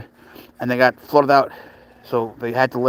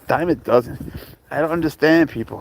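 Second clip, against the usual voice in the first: ordinary delivery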